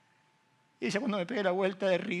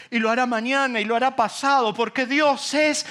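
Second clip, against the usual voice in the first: loud, much higher, slow sermon voice; full-force preaching; 9 semitones higher, 10 dB louder than usual. Acted. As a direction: rouse the congregation. (predicación a toda voz)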